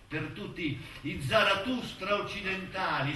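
normal talking voice

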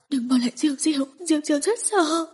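put-on small child begging through tears; crying like a child. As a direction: wail it like a frightened little girl. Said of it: "khóc như một đứa trẻ".

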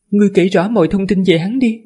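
high, composed lady's voice; man doing a woman's voice. Acted. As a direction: speak as the gracious mistress. Female tower mistress speaks.

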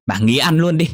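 high, loud cutting order; angry snap. Acted. Daughter ordering her mother to stop eating, loud and angry. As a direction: slam the words down on her.

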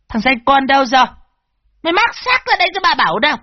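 high, fast screeching rage; shrieking stepmother. A narrator's reading. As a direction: shriek at the boy furiously. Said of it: "nó rít lên". (rít lên giận dữ)

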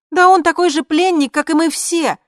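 excited Lina. Acted. Friend Lina on the call telling the news, high and excited. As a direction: chatter eagerly, high and loud.

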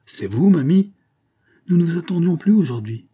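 high, loud astonished greeting; surprised exclamation. A narrator's reading. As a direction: exclaim at an unexpected visitor. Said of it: "s'écria la dame".

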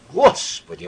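high, loud gasp of alarm; startled exclamation. A narrator's reading. Elena exclaims at the telegram, startled. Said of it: "воскликнула Елена".

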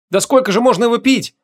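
put-on high, exasperated girlish question; high-voiced girl's disbelief; astonished. A narrator's reading. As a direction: ask in amazement, pitch way up. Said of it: "поразилась Ванесса".

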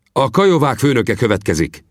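Vinetú sternly calling the Kiowa chief to order; firm, commanding chieftain; stern rebuke. Acted. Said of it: "mondta Vinetú".

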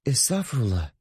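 ürkek. A narrator's reading. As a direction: answer shyly, a touch of fear. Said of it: "Çiğdem yine ürkek yaklaşarak". (ürkek, çekingen bir sesle)

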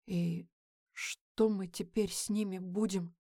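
soft, shaken question; quiet. A narrator's reading. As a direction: ask it quietly, stunned. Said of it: "говорит Джеймс тихо".

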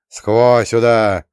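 loud, slow, drawn-out call; smirking call. A narrator's reading. Ghost Bear taunts the shooters, calling slowly with a smirk. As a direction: stretch it out with a smirk. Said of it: "ухмыльнулся призрак медведя".

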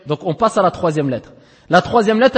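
normal talking voice

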